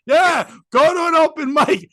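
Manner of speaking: high, loud, drawn-out sarcastic jeer; acted-out scornful shout; mock jeer. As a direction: jeer back slowly as the heckling kid. Retold kids' sarcastic jeer.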